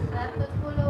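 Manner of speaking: breathy whisper, barely audible; soft whispered prompt to the class; whispered answer. Real, not acted. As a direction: slip the answer out under your breath. Quick answer to a class question.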